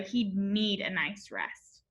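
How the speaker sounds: ordinary delivery, nothing marked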